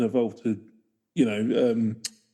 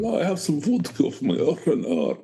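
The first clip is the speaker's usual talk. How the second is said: higher, hammed-up Russian voice; Russian accent; 4 semitones higher than usual. Co-host joking about vodka, Russian accent. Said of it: "I can do a Russian accent".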